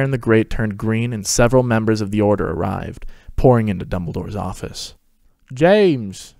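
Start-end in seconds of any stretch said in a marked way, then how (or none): 5.5-6.3 higher, louder happy call; excited exclaim. A narrator's reading. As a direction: call out to your best friend. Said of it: "Sirius exclaimed".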